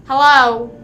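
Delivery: loud mock greeting. Quoting what the chatter should have said, loud. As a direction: call the greeting out brightly. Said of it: "You did not say".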